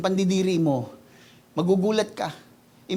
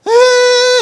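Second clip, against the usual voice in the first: mock wail; 9 dB louder than usual.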